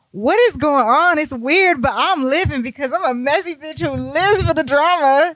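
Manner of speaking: high-pitched, worked-up outburst; over-the-top high, thrilled voice; hammed-up excitement. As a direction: squeal it, loving the drama. Acted delight at the drama.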